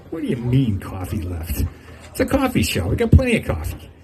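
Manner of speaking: lightly acted baffled shop worker, voice rising; incredulous coffee lady. Coffee lady answers a silly question.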